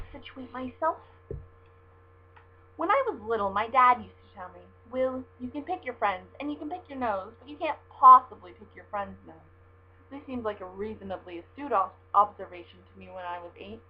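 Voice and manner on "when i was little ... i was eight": storybook reading voice; reading a novel aloud. Acted. Reading chapter one of her book to viewers, story narration. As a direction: read the opening like a story to listeners.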